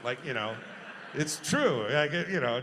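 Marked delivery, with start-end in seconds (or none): none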